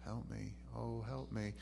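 soft, limp, fading help me; mock listless cry for help; half-hearted help. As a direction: mumble a plea nobody believes. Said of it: "you don't really care".

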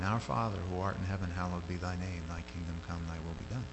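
low, quiet run-through of a prayer; rote recitation. Shown as a demonstration. Giving the outline for prayer, quietly recited. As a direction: recite it softly on a low, even pitch.

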